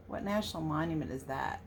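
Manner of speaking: plain reading voice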